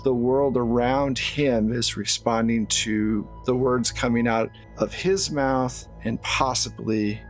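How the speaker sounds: ordinary talk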